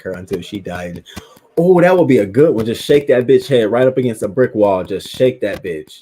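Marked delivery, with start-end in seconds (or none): 1.6-6.0 loud, raised-pitch venting; worked-up relish. Real, not acted. Venting about revenge on the babysitter, loud and worked-up. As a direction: burst out loudly, relishing the revenge.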